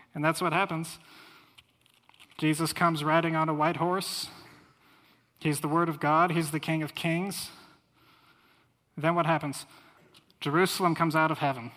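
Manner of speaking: loud, exultant preaching; preaching at full force. Acted. Preacher tells the congregation of Christ's coming, exultantly preaching. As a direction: proclaim the triumph with fire.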